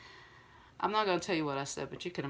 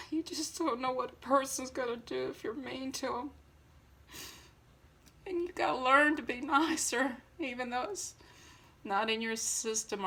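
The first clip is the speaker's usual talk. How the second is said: emotional plea; 7 semitones higher than usual. Real, not acted. Pleading with viewers after brother's suicide.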